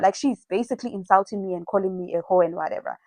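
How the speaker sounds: ordinary talk, nothing marked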